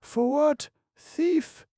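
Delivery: very high, loud shrieking accusation; screamed in disgust. A narrator's reading. Ivan Dmitrich shrieking at the doctor, very high and loud. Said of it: "screamed Ivan Dmitrich".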